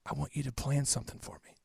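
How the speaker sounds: soft, barely there imitation of a raspy whisper; dad's raspy whisper. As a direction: rasp it out like a sick man who can barely talk. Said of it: "raspy when he t-, talk".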